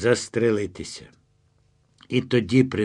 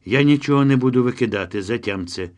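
ordinary delivery

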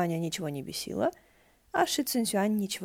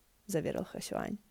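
plain reading voice

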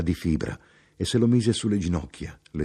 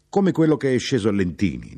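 raised, louder, animated voice; high, insistent chatter; talkative traveller, pressing his point; 5 semitones higher, 5 dB louder than usual. Acted. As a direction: press the point eagerly on a stranger.

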